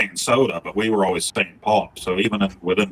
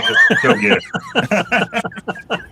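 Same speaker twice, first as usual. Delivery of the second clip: laughing exasperation; much slower than usual. Retold or imitated. Exasperated at Boston roommate.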